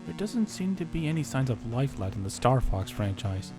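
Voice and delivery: ordinary delivery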